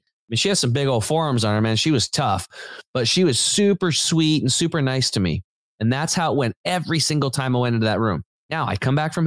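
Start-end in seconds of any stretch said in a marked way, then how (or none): none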